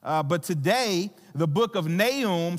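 usual speaking voice

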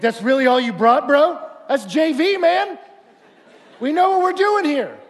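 loud, high mock indignation; acted-out cocky heckler; scornful dismissal. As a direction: scoff at a message far too basic. Scornful listener dismisses the basic message.

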